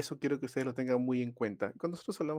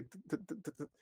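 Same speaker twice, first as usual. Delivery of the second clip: stuttering demo; 5 semitones higher, 11 dB quieter than usual. Shown as a demonstration. Teacher demonstrates a stutter to students, quiet repeated tu tu. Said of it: "así, se llama disfemia".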